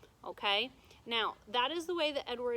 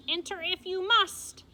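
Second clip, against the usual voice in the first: loud, higher witch's grudging reply; a hammed-up cranky witch; terrible voice; 9 semitones higher, 9 dB louder than usual. A narrator's reading. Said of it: "A terrible voice answered her".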